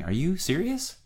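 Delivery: high, disbelieving question; can't tell if she's kidding; incredulous. Retold or imitated.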